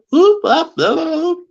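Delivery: very high, loud whooping chirp; mock Sasquatch flute call; imitated fluty chirps. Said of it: "make fluty chirps".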